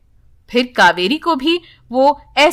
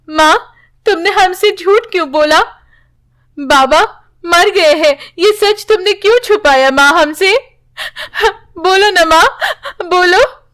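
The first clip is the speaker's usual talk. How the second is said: put-on upset young daughter; 7 semitones higher than usual. Daughters confront mother about the lie, higher hurt voice. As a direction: demand the truth from mother, tearful.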